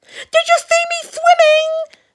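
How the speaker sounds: high, loud excited squeak; Roo. Acted. Roo just out of the water, excited high squeak. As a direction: squeak it out, bursting with pride. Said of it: "squeaked Roo excitedly".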